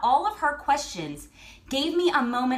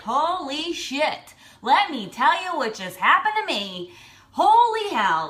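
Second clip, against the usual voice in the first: amazed; 3 semitones higher, 5 dB louder than usual. Retold or imitated. Woman telling husband what just happened, amazed. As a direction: stretch it out, floored by the news. Retelling her outburst to husband.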